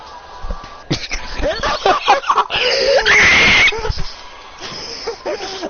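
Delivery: high, loud laugh building to a shriek; maniacal screaming laugh. Acted. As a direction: cackle until it becomes a long scream. Villain laughs madly over the dead.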